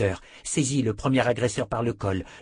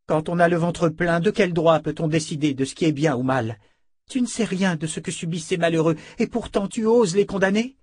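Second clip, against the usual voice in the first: higher, louder indignant scolding; indignant rebuke; 6 semitones higher, 4 dB louder than usual. Acted. Nietzsche rebukes Richard for insulting the poor: higher, louder indignation. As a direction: defend the poor against his insults.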